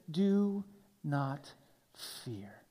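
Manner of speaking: soft breathy whisper, dropping away. Shown as a demonstration. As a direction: breathe it like a secret. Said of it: "this common statement".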